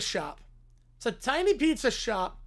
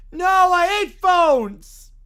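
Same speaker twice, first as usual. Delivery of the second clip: slow, loud, higher-pitched protest; loud refusal; 7 semitones higher, 12 dB louder, much slower than usual. Acted. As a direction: dig in and wail it out. Character refuses to answer phone.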